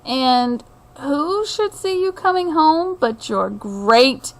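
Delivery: usual speaking voice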